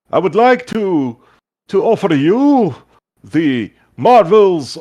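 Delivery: high, slow, grand merchant blob; goo shopkeeper voice. Acted. Goo shopkeeper welcoming a lady to his store, high and showy. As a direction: sell it like a pompous jelly shopkeeper. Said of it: "he kinda looks at you and goes".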